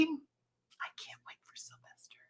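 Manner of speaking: slow excited whisper. Real, not acted. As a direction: breathe it out like a secret. Excited aside about release.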